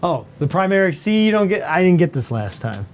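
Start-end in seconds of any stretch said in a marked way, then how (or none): none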